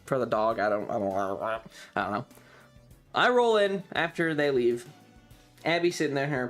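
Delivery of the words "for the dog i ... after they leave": ordinary talk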